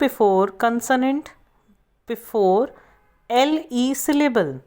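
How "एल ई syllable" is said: ordinary talk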